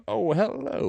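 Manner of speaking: hammed-up Southern greeting out of the mouth's corner; exaggerated Southern drawl. Shown as a demonstration. Friend demonstrating his accent from the corner of his mouth, Southern drawl. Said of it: "does that Southern drawl".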